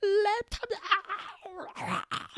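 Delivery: high hollered security call; mimicking an officer hollering laptop; mock yell. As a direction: bellow it like airport security. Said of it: "就会很大声的喊说".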